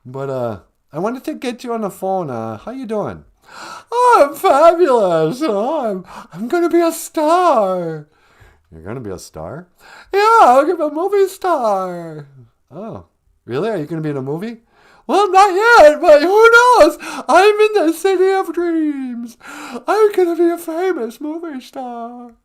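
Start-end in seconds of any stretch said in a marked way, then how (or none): 3.9-8.1 much higher, louder, gushing caricature of Megan; mock Megan voice. Host plays Megan on the phone, high gushing squeal. 10.1-12.3 much higher, louder, gushing caricature of Megan; mock Megan voice. Host plays Megan on the phone, high gushing squeal. 15.1-22.3 much higher, louder, gushing caricature of Megan; mock Megan voice. Host plays Megan on the phone, high gushing squeal.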